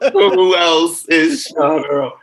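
drawn-out, high, loud pretend indignation; mock appalled. Guest pretends shock at another person sharing the name, mock appalled. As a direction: ham up the disbelief, slowly. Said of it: "and I'm appalled".

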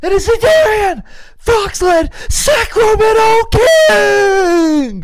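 high, loud arena announcer; full-throated PA team introduction; yelling announcer. Pretend. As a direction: yell it out, stretching the team names. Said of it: "you said you couldn't yell".

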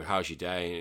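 low, dull, flat greeting; deliberately dull demo; boring voice. Shown as a demonstration. Podcaster showing the boring way to greet listeners, dull and low. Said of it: "I can speak like this ... it'll be just a little bit boring".

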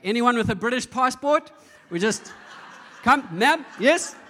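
hammed-up official summoning passengers over a queue; calling out. Admissions guy calls out priority boarding.

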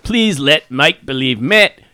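put-on Aussie accent; Australian accent. Reading Aussie listener's mail on a podcast, mock Australian accent. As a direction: read it as a hammed-up Australian. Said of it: "read it in an Australian accent".

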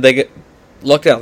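ordinary delivery

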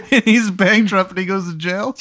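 laughing through the words; can't keep a straight face; laughing while talking. Real, not acted. Narrator laughing at her own grim twist.

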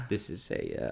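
ordinary delivery

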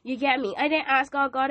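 ordinary delivery

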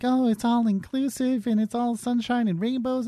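high, mock-cheery parroting; mocking false positivity. Critic parodying the show's wholesome cast, sugary mockery. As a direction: imitate relentlessly wholesome people, sarcastically. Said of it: "all these people act like".

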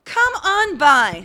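hammy announcer. Pretend. Hammy sign-off.

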